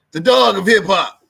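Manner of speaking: announcer shout of the nickname; shouted hype tag. Acted. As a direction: yell the title like a radio drop. Repeated title, shouted louder.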